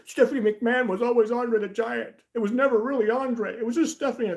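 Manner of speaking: high, loud hammed-up insistence; mock conspiracy theorist. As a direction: mimic a silly believer excitedly. Acts out absurd theorist claim.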